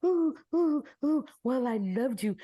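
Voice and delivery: hammed-up tearful confession; mock crying. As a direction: whimper it like a weepy old man. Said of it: "and he's crying".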